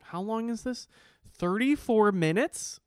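high-pitched, incredulous outburst; mock exasperated rant. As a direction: stretch the words in comic outrage. Acting an impatient, ranting listener.

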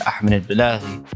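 ordinary talk

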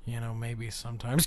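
ordinary talk, nothing marked

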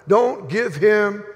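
put-on a guard snapping the order in a Georgia accent; Georgia Roman guard. Guard ordering no water, mock southern bark. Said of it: "in his best Georgia Roman voice".

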